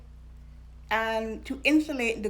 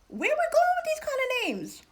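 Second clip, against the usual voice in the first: very high-pitched comic protest; mock incredulous. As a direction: squeal the question in joking disbelief. Mock protest at uncles' old-fashioned names.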